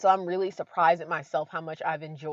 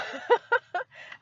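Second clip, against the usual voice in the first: high laugh; 4 dB louder than usual. Real, not acted. Laughing at herself about the cold.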